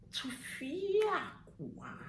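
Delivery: very slow, high Tufiakwa with long "fia"; demonstrated exclamation. Shown as a demonstration. Telling viewers to look, very drawn-out high Tufiakwa. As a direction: stretch Tufiakwa as far as it goes. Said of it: "Look at me".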